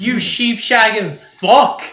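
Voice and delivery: play-angry tirade at the director; mock rant. Ranting at Peter Jackson.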